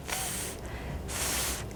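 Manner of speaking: "f" made as TH, only air between the teeth; long soft TH with no voice; voiceless TH sound. Shown as a demonstration. Teacher showing T and H together, voiceless TH. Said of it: "unidas la T y la H".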